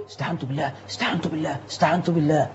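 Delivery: low soft murmur of the congregation; mumbling worshippers. Retold or imitated. Mocking the worshippers murmuring during prayer, low and soft. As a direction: mutter it under your breath like the crowd. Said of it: "تلاقي اللي حواليك كلهم".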